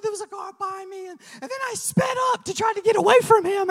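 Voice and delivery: squeaky, racing tearful confession; mock crying wife. Wife confessing she sped away from a cop, mock crying. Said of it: "'Cause that's how Marissa's cries".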